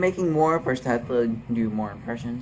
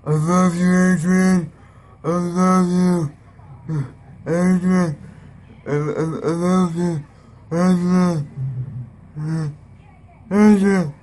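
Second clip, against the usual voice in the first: loud, higher, slurred Rocky calling for Adrian; 5 semitones higher, 4 dB louder than usual. Acted. [name]'s Rocky impression calling Adrian, loud and slurred. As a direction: bellow her name like a punch-drunk boxer. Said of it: "My next impression is going to be Rocky".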